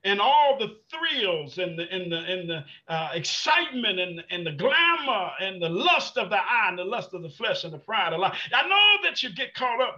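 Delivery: loud, high, driving sermon; fiery preaching. Real, not acted. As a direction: preach it hard to the church. Preaching at full force.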